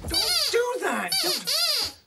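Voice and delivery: high-pitched, drawn-out plea to stop; flustered, voice shooting up; startled protest. Acted. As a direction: object as if just made to jump. Startled again by dog creeping up.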